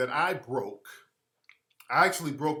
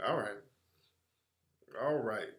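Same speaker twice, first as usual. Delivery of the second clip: usual speaking voice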